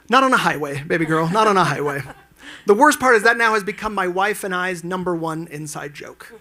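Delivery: ordinary talk, nothing marked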